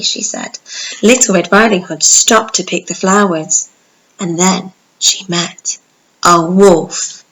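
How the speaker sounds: bright, lively storybook narration; animated storytelling voice; reading to children. Acted. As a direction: tell it like bedtime story for little ones.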